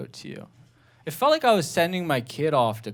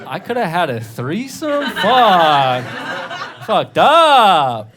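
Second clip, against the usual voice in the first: louder, higher regretful curse; frustrated realization; 6 semitones higher, 9 dB louder, much slower than usual. Retold or imitated. Realizing he missed a threesome, loud frustrated curse. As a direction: kick yourself out loud. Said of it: "and I was like".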